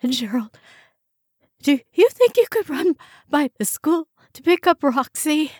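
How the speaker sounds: a pained, pleading request from a sick woman; ill woman's plea. A narrator's reading. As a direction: beg him for help through the sickness. Joyce asking, ill.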